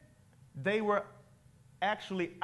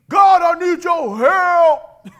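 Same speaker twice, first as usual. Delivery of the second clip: loud slow desperate cry, higher and far louder; deep desperate cry to God; 8 semitones higher, 15 dB louder, slower than usual. Pretend. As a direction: bellow it like a strongman begging for help. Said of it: "it might have been deep".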